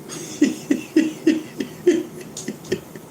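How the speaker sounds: real high-pitched airy laugh; high giggle. Real, not acted. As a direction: let out a high, breathy giggle. Laughs at own joke.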